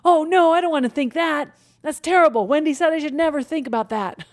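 high, loud, mock-panicky fretting; panicky worry. Shown as a demonstration. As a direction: fret in a hammed-up panic. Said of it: "and don't say".

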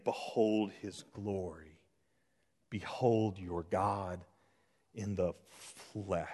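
soft, low and slow proclamation; hushed reverent cry. Acted. Preacher echoing John's cry to behold, hushed and slow. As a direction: drop to a hush, pausing between phrases. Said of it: "cried again and again".

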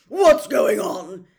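very loud, slow growled question; outraged bellowing growl; angry growl. A narrator's reading. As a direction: demand it in a drawn-out roar. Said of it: "growled Uncle Vernon".

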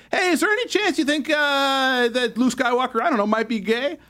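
imagined interviewer. Acted. Host acting a reporter fishing about Luke Skywalker, mock interviewer voice. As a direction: angle for a quote as a mock reporter. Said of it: "this is the conversation I'm assuming happened".

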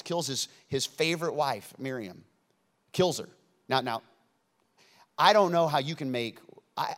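ordinary delivery

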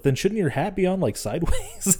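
dad's joke broken by laughter; laughing dad quote. Retold or imitated. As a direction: quote the dad while cracking up. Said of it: "He's just like".

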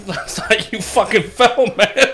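high, slow words through laughter; real laughter lifting the voice; laughing tease. Real, not acted. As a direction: tease while cracking up. Laughing over the other one's fall.

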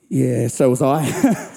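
low, slow deadpan admission; mock sheepish confession. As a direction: drag it out in a deep voice. Quotes brother-in-law's sheepish confession.

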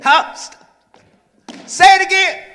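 loud, high, eager prompt to say it again; excited urging. Real, not acted. Pastor to reader, loud excited urging. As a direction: egg the reader on, voice jumping up.

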